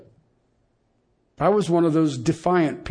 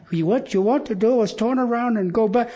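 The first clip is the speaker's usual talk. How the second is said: imitating the phone's navigation voice, pitched higher; mock the GPS telling him to turn back; 5 semitones higher than usual. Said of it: "it makes fun of the way I talk".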